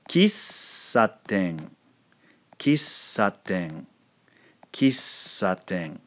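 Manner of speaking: Kissaten very slow, hovering on a long hissed ss; elongated S. Shown as a demonstration. Teacher showing small tsu before S, lengthened ss in Kissaten. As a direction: hold the S of Kissaten for an extra beat. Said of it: "Notice that I'm hovering here".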